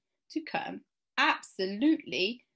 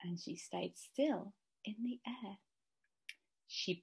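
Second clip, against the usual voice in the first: whispered, much softer; hushed whisper; 12 dB quieter than usual. A narrator's reading. Narrator building suspense as she hangs still, whispering. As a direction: breathe it for suspense.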